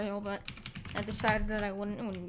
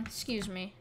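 ordinary delivery

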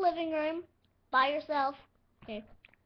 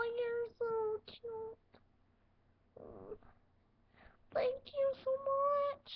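gushing thanks; 8 semitones higher, 3 dB quieter, slower than usual. Acted. Grateful figure gushes.

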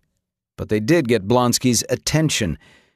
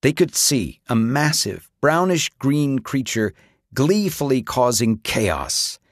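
usual speaking voice

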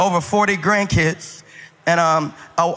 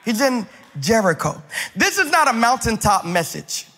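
preaching; 3 semitones higher than usual. Acted. Pastor preaching to the congregation in a rising cadence. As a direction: preach it, building each line.

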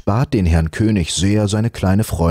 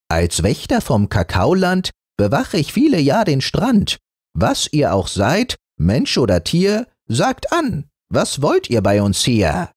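higher, formal rhyming guard; monkey guard voice; 7 semitones higher than usual. Acted. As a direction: recite it like a pompous sentry. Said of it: "machte eine höfliche Verbeugung und sprach".

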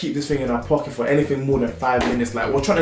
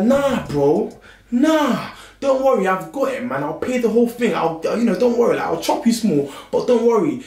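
eager insisting; 8 semitones higher than usual. Retold or imitated. Insisting he pays for all.

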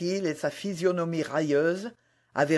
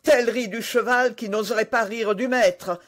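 loud, higher, angry threat; raised angry voice; furious cry; 5 semitones higher, 5 dB louder than usual. A narrator's reading. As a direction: fling the warning in fury. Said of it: "s'écria l'émule de Tréville furieux".

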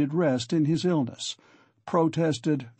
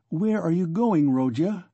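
ordinary delivery, nothing marked

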